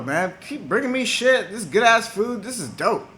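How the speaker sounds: mock delight, pitched high; delighted god. Enki delighted by food offerings.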